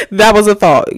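That punched loud, said with force. Shown as a demonstration. Addressing viewers, repeats her thought with loud emphasis. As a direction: say That was a thought firmly and loud.

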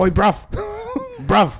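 put-on high, loud British-accented dog hail. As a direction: holler at a passing dog, mock British. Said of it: "and he's like".

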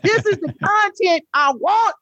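very high-pitched, gleeful demand; retold excitement. Recounting a reaction to Red Table Talk drama, excited. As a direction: squeal it high like a thrilled fan. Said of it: "I was like".

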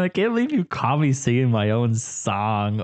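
usual speaking voice

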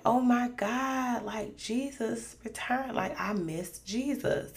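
high, slow, mildly distraught; retold crying dismay. Dreamer realising she missed Jesus, imitated tearful dismay. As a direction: lament it softly, stretched out, imitating tearful shock. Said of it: "So I was just crying".

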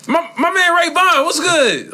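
high, loud hype greeting; demo greeting. Shown as a demonstration. Demoing how to introduce Ray Vaughn, loud and high. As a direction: shout the intro like greeting a friend. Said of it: "Like something like that".

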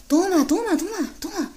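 high pushy street voice handing it over; mock thief voice. Thief urging her to take the phone, pushy high voice. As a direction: shove the phone back at her, insisting.

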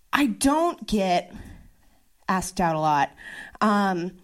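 ordinary talk, nothing marked

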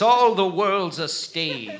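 slow, grand stage declamation; theatrical recitation. Acted. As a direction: declaim it like a Shakespearean actor. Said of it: "How about this?".

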